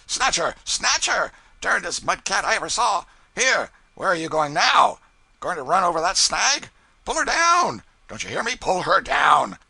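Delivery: high, loud mock tirade of the pilot; playing Brown. Ritchie teasing the cub by playing Brown, loud and high. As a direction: ham up the ranting pilot to tease. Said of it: "play Brown".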